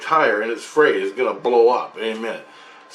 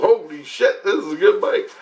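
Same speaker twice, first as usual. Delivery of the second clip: higher-pitched retold amazement; impressed exclamation; 9 semitones higher than usual. Retells impressed reaction to bike.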